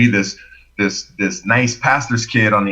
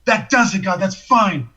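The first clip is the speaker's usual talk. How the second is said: high, fed-up cry to God; retold outburst of giving in; exasperated prayer; 7 semitones higher than usual. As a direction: throw it upward in exasperation. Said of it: "I'm praying to God".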